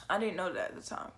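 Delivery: fast, soft whisper of "I didn't know that at the time"; whispered aside. Shown as a demonstration. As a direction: lean in and whisper it like a secret.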